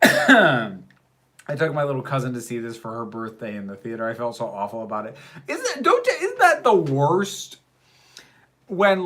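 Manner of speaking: usual speaking voice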